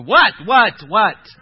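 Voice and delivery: loud, repeated, aggressive challenge; mean. Acted. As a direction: fire it at them to scare them. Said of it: "I like the mean Jesus".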